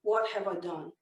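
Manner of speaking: low, soft, guilty self-talk; acted hushed dismay at oneself; remorseful husband. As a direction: sink into quiet regret. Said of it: "he begins to feel like a real heel".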